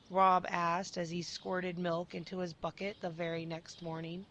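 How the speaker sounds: usual speaking voice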